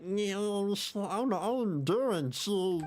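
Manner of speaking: high, slow dorky voice; mock the new nerdy voice; nerdy Durin impression. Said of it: "he just sounds like".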